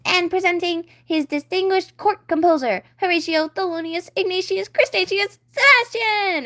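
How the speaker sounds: high, loud, building announcement; grand herald announcement. Acted. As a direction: announce each name grander. Seahorse announcing court composer.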